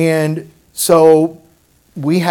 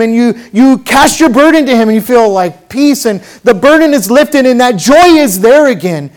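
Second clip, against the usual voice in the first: raised, louder sermon voice; impassioned preaching; 6 semitones higher, 6 dB louder than usual. Real, not acted. Preacher to congregation on the joy of surrendering, impassioned preaching. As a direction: preach it out with rising pitch and force.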